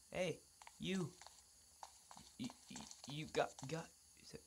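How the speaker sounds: ordinary delivery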